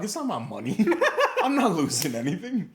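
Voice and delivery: high, loud laughing through words. Real, not acted. Telling friend about the robbery, laughing. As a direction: let the laugh break in.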